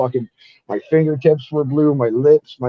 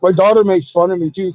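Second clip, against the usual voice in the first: normal talking voice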